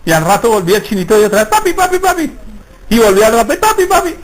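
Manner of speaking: put-on high, whiny little child tugging at dad; child calling papi. A little boy nagging his dad for attention, high child voice. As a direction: call papi like a small boy pestering. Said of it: "venía el niño y le jalaba".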